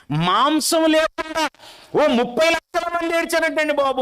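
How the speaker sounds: mock the whiners from the pulpit; scornful preaching. Preacher scorns weeping for meat.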